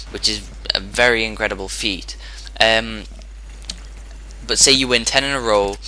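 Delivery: ordinary talk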